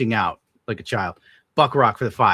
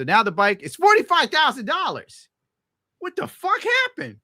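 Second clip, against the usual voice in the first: much higher and louder, aghast; shocked outrage; 5 dB louder than usual. Real, not acted. Seeing the forty-five thousand total, high shocked outrage. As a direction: react to the total in disbelief.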